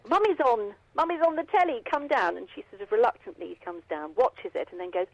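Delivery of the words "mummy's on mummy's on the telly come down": put-on high, raised sing-out to a child; retold shout upstairs. Mum calling her daughter down to watch her on telly, retold shout. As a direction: imitate a mum calling up to the bedroom. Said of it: "I usually shout up the bottom of the stairs".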